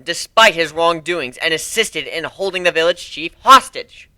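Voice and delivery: forceful courtroom argument. Acted. Griffin arguing case in court.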